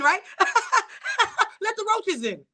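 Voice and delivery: laughing it out, high and giggly. Real, not acted. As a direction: say it while cracking up. Joking about letting roaches in.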